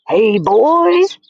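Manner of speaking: high, loud call across the kitchen; waiter shouting. A narrator's reading. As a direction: call the boys over. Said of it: "he shouted".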